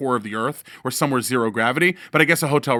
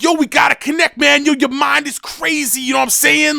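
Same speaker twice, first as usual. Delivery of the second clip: much higher, loud, hyped; 12 semitones higher, 5 dB louder than usual. Retold or imitated. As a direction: yell it like an all-caps text. Imitating [name] email.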